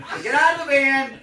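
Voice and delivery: ordinary talk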